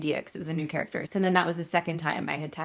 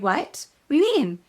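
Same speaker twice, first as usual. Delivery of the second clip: high, louder startled question; feigned innocence; 9 semitones higher, 6 dB louder than usual. Retold or imitated. Actress caught out by casting director, mock-innocent. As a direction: act baffled, voice jumping up.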